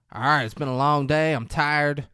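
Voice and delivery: hammed-up tired grump; grumpy film character. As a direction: order everyone to bed, mock-weary.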